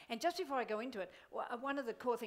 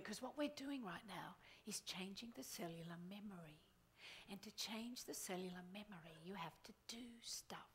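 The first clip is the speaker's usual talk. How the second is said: softer, unvoiced confiding whisper; 4 semitones lower, 11 dB quieter than usual. Real, not acted. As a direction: lean in and whisper it like a secret. Whispers a secret aside.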